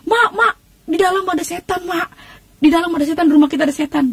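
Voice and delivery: high-pitched mock cry to mom; retold yelling. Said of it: "Saya bilang, saya teriak". (teriakan panik menirukan diri sendiri)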